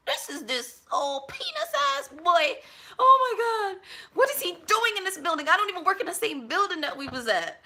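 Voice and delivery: high, loud, panicked disbelief; panicked inner shriek. Retold or imitated. Telling a friend about spotting the harasser, shrieking in panic. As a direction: shriek it in a panic inside your head. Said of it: "In my head, I was like".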